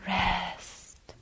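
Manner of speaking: fading to a slow whisper; soft whispered rest. Retold or imitated. Tibetan teacher soothing an exhausted mind, whispered slowly. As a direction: breathe the words out, barely voiced. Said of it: "in this beautiful accent".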